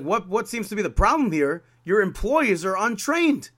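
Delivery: a fed-up customer scolding the guards; exasperated retort. Retold or imitated.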